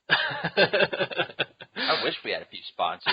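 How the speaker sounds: words spilling out through laughter; slow, laughing delivery. Real, not acted. Joking about having no sponsors.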